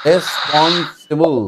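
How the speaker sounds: রেসপন্সিবল slowly, in spaced chunks; syllable by syllable. Shown as a demonstration. Teacher modelling for pupil to repeat, slow and syllabic. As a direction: stretch out রেসপন্সিবল part by part. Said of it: "এরকম করে উচ্চারণ করলে".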